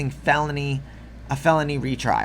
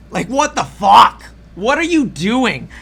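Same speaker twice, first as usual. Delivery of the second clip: loud disbelieving yell, higher; outraged yell; 6 semitones higher, 7 dB louder than usual. Real, not acted. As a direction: shout it at him in disbelief. Outraged rant at Trump.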